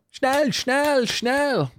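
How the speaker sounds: barked high German commands; movie villain German accent. Pretend. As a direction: snap it like a film villain. Barking orders at slow walker.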